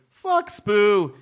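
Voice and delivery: loud, high mock insult; shouted greeting. Host's special shout out to an old friend, hollered. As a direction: holler it as a running-joke hello. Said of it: "I greet him like that".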